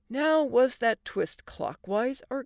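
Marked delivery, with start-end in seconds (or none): none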